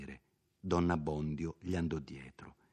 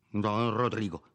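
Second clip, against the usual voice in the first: hurried; 2 semitones higher, 3 dB louder than usual. A narrator's reading. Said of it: "pronunziò in fretta il forzato".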